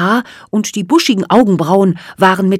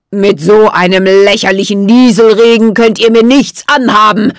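loud, scornful villain voice; villainous hissing; 3 semitones higher, 7 dB louder than usual. A narrator's reading. Jack Frost mocking the fairies' wall of water, hissing. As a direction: spit it out with icy contempt. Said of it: "zischte Jack Frost".

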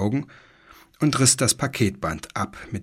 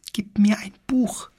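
very high, whispered little creature voice with a lisp; a tiny furry beast begging in a high lisp; 12 semitones higher than usual. A narrator's reading. Fur pig demands a book, high lisping whisper. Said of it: "lispelte das Pelzschwein".

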